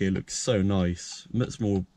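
ordinary talk, nothing marked

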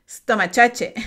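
Stomachache with a ch sound, rising; joking wrong reading of Stomachache; wrong pronunciation again. Shown as a demonstration. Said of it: "suena súper chistoso".

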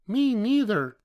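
tiny, very high joey voice; young kangaroo. A narrator's reading. Joey echoing mother from her pouch, high little voice. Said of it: "the young kangaroo in her pouch said".

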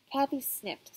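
usual speaking voice

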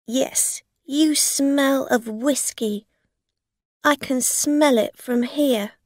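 loud, firm threatening voice; stern accuser. A narrator's reading. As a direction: press her hard. Rosa threatens.

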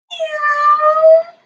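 high cat meow. Shown as a demonstration. Teacher leading kids' yoga, a high cat meow. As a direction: meow like a kitten for the kids. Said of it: "I wanna hear those meows".

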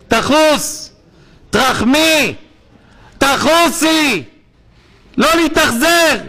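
high, loud pleading cry; impassioned plea. Real, not acted. Rabbi imploring his listeners, loud and high-pitched. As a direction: beg the listeners for mercy, full voice.

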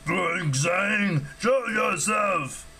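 ogre bellow. A narrator's reading. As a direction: bellow it slowly like an ogre calling someone out. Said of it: "an ogre bellowed".

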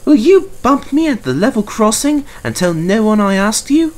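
high, loud sly engine voice; conspiratorial Mavis. A narrator's reading. Mavis plotting with the trucks, high and sly. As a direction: let them in on a scheme, high.

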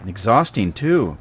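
holding breath. Real, not acted. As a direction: speak while holding your breath. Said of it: "I'm holding my breath while I do this".

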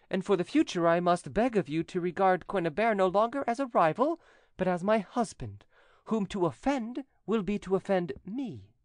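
high-pitched, imperious declaration; decisive, leaving no doubt. A narrator's reading. Widow warning cousin to respect her future husband, imperious. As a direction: lay down the law firmly. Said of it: "The tone in which these words".

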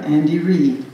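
usual speaking voice

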